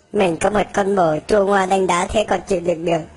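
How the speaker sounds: ordinary talk, nothing marked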